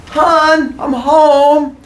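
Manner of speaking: high, drawn-out call through the house. Acted. Husband back home to his family, high sing-out call. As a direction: holler a cheery homecoming.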